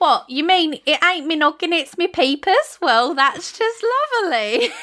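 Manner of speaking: high mock-English cartoon boy; Bart's English accent. Co-host shows off impression of cartoon boy, high mock-English accent. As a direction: put on a high, hammed-up common English accent. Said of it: "do a really good impression of this".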